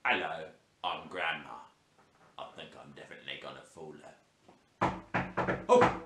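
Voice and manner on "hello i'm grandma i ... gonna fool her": grandma voice. Shown as a demonstration. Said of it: "I've practiced my grandma voice".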